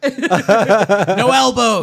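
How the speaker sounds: very loud, stretched referee shout; UFC referee. Acted. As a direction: bellow it slowly across the cage like a ref. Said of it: "it sounds like a U F C referee".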